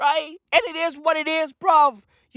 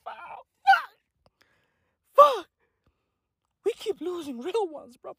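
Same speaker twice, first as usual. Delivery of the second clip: mock grief; 4 semitones higher, 5 dB quieter than usual.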